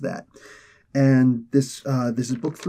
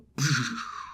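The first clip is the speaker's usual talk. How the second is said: mouthed lightning-bolt whoosh; vocal sound effect of a lightning strike; imitated lightning crash; 6 dB quieter than usual. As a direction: make a hissing zap with your mouth.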